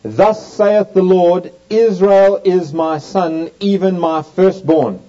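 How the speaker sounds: each phrase slow, loud and weighty, firstborn stressed; solemn scripture emphasis, drawn out; slow emphatic reading. Shown as a demonstration. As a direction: proclaim it slowly, leaning hard on firstborn. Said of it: "notice the emphasis here".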